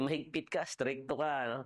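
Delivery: ordinary delivery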